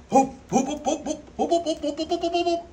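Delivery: high, rising playful whoop calls; calling the fish. Real, not acted. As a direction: chant to rile the fish up. Trying to get fish excited.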